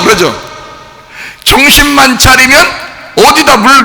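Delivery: usual speaking voice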